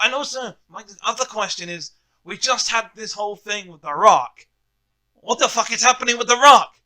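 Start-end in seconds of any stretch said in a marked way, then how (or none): 5.1-6.7 exasperated outburst. Real, not acted. Exasperated wrestling fan rant.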